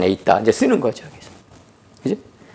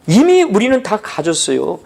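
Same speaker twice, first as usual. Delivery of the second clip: usual speaking voice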